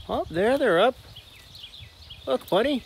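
high, bright-eyed call to the dog; excited to dog. Real, not acted. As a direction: point it out to your dog, thrilled. Showing the pigs to his dog.

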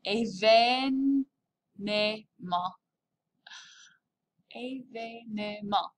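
slow, syllable-by-syllable Événement; Événement stretched out in careful French; careful French pronunciation. Shown as a demonstration. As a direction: sound out Événement with every French accent. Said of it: "make sure I'm getting my accents right".

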